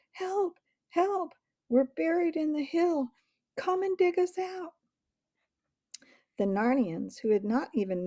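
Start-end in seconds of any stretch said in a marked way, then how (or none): 0.1-4.7 high urgent yell; yelling for help. A narrator's reading. Jill yelling up to the dancers for rescue, urgent and high. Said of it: "yelled to the dancers".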